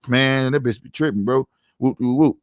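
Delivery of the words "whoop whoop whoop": usual speaking voice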